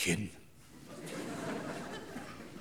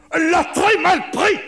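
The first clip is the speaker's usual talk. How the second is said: raised narration; 13 semitones higher, 11 dB louder than usual. A narrator's reading. Annonce la colère de sa femme.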